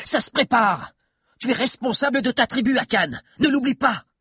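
high, urgent exclamations; crying out. A narrator's reading. Adviser reminding the chief of his duty, crying out. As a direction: cry it out high, without much added volume. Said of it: "cria Mordelia".

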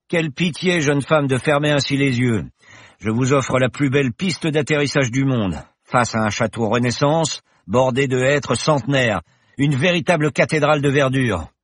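the confident pilot professor, merry; cheerful professor. Acted. Professor reassures Paloma in the plane, jaunty and loud.

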